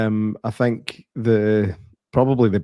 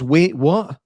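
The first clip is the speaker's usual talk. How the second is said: mock cliché line; 5 semitones higher than usual. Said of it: "who says".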